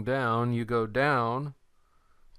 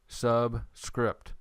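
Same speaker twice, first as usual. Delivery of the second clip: "subscript" slowly pulled apart into sub and script; word split in parts. Shown as a demonstration. Teacher shows where the iota goes, "subscript" split in two.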